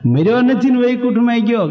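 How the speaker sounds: the living father scoffs at the lie; indignant protest. Acted. Father in the hall protests he isn't dead, indignant.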